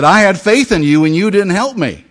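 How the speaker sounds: loud, high indignant complaint; mad at God. Acted. Drowned man confronts God in the resurrection, loud and indignant. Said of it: "he's mad at God".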